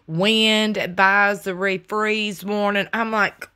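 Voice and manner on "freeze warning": usual speaking voice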